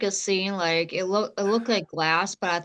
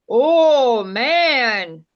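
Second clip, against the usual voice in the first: loud, higher mock gasp of shock; 4 semitones higher, 9 dB louder than usual. Friend reacting to the caller's filthy line, mock shocked. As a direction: act scandalized. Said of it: "and I'm like".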